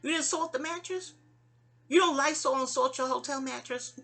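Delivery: high, insistent mock scolding; high-pitched voice of advising friends; imitated spiritual friends. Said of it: "So they be like".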